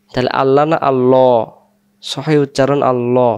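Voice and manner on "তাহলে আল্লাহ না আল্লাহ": ordinary delivery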